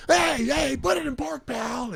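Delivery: high, strained comic shout; cop yelling. Retold or imitated. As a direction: scream orders like a flustered cop. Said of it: "the way the cop was like".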